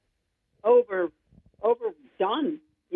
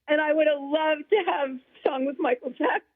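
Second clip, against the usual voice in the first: laughing wish; 5 semitones higher than usual. Real, not acted.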